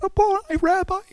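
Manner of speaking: high, full-throated joyful cry; joyful scream. Retold or imitated. Mary Magdalene screaming for joy at the risen Christ. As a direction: cry out like Mary finding him alive. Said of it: "she screamed at the top of her lungs".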